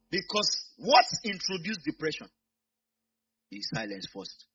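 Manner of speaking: ordinary talk, nothing marked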